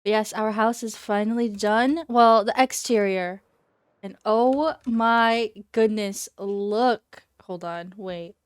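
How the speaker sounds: normal talking voice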